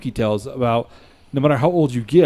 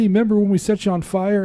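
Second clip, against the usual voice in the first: high, bright punchline voice; punchline delivery; 8 semitones higher than usual. Retold or imitated. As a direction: land the punchline. Punchline of joke.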